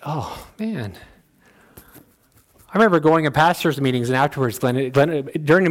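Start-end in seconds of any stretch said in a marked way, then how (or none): 0.0-0.9 soft, resigned groan of a friend; a hint of an exasperated friend; mock weary sigh. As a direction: mutter it like a tired friend. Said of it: "Glenn's like".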